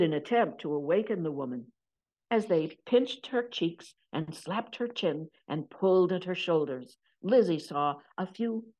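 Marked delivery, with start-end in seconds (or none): none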